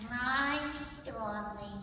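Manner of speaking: put-on sweet childlike puppet voice; cute little lamb puppet; Lamb Chop puppet voice.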